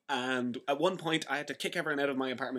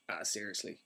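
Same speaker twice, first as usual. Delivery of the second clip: muttered dismay; 7 semitones lower, 12 dB quieter than usual. Retold or imitated. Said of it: "I'm just like".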